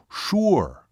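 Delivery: high, falling, certain "sure"; imitated Seminole man. Seminole confirms to doubting waitress, short and emphatic. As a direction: confirm it in one emphatic word. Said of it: "even imitating his voice and gestures".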